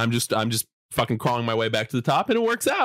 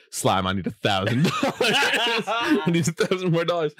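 tag; 7 semitones higher than usual. Retold or imitated. Acted begging for money in retold story.

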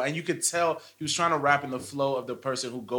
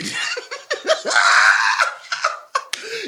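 howling laugh, very high; loud burst of laughter; 9 dB louder than usual. Real, not acted. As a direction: crack up loudly. Loud laugh at [name] song.

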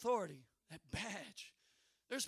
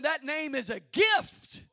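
normal talking voice

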